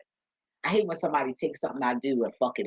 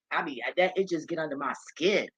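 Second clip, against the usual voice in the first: ordinary talk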